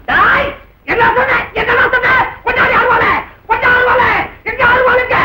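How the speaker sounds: high-pitched, frenzied demands; enraged yelling. Acted. Man calling for a sickle to attack, enraged yelling. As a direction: explode in rage, pitch kept high.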